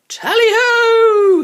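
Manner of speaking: long, loud, high hunting cry; hallooed. A narrator's reading. Barney cries the hunt call as he gives chase, loud and drawn out. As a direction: sound the hunt like a huntsman. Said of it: "he hallooed".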